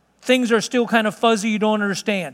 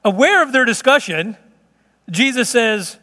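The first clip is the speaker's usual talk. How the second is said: ordinary talk, nothing marked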